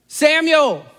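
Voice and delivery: put-on a distant voice calling the boy's name; calling voice of God. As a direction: call out from afar, drawn out. God's voice calls the boy.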